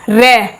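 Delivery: "रे" sounded out on its own; deliberate teaching "रे"; articulation demo. Shown as a demonstration. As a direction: isolate "रे" with the tongue at the gum ridge. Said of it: "अब यहाँ पे देख लो आप".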